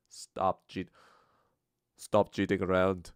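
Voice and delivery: lightly put-on Indian accent. Shown as a demonstration. Trying the Russell Peters thing, a tentative Indian accent. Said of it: "my Indian accent".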